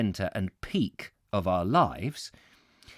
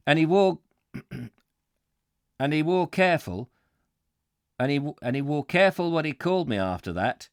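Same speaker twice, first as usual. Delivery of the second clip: higher, emphatic Yorkshire warehouseman; Yorkshire accent; 5 semitones higher than usual. Acted. Warehouseman finishes his blockhead story, emphatic Yorkshire accent. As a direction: wind up the tale in broad Yorkshire. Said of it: "this aggressive Yorkshire voice".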